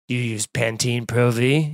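creepy voice. Retold or imitated. Passenger creeping out the woman with long hair, low creepy voice. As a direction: purr it low and unsettling, like a creep on a plane. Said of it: "you just smile real creepily".

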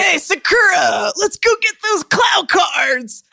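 high, loud, slow stretched anime-dub shout; dubbed anime boy. Acted. Host mocking English anime dubs, calling to Sakura, high boyish shout. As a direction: call out like an overdubbed cartoon boy. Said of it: "dubbing of Japanese anime into".

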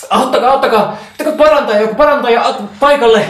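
very high, loud cries for help; frantic shouting. Acted. Riia beside the badly wounded mother, frantic high shouting for help. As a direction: yell desperately for a healer. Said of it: "joka huutaa".

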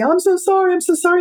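a sister's pained apology, mildly imitated; retold sobbing plea. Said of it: "she just started sobbing and crying and saying".

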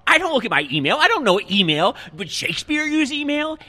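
put-on an old man scornful of email; indignant old boss. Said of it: "he says".

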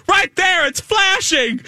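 high, urgent mock shout; retold frantic yell. Pilot pointing co-pilot to flashing lever, mock panic. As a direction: answer back in exaggerated panic.

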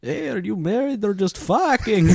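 high, drawn-out vampire heckle; hammed-up vampire hack comic; Count Hacula. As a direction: tease the front row as a corny vampire. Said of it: "dumb hack shit in a vampire voice".

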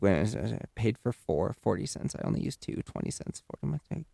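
quiet muttering to herself, fading to a whisper; whispered mental arithmetic; muttered math. Real, not acted. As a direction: count it out under your breath. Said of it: "I had to do math in my head".

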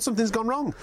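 normal talking voice

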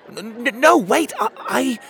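very high, stammering plea; high-pitched flustered stutter; panicked stammer. A narrator's reading. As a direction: stumble over the words as a desperate little boy. Boy stammers as man leaves.

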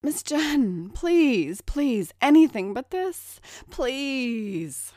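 high, loud desperate pleading; begging for my life. A narrator's reading. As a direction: beg for mercy in pain. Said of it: "howling and begging for mercy".

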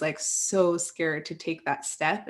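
ordinary talk, nothing marked

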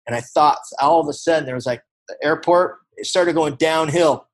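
usual speaking voice

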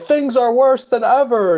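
high, loud hammed-up line; dramatic extra. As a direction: overplay it like a movie extra. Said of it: "you're like".

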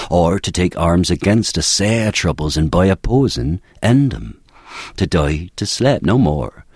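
Hamlet in Elizabethan original pronunciation accent, rolled r's, measured; Shakespearean original pronunciation. Shown as a demonstration. Ben reciting Hamlet's soliloquy for the host in original pronunciation accent. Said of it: "Hamlet's to be or not to be in, in original pronunciation".

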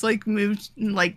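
ordinary delivery

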